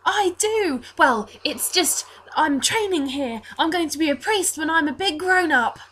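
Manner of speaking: put-on high, earnest young boy; Reese. Altar boy telling of his priest training, high proud voice. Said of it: "twelve-year-old-ish looking blonde altar boy".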